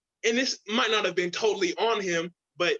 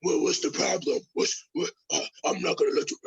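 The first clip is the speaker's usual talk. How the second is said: Batman voice; 3 semitones lower than usual. Retold or imitated. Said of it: "And then across from you got".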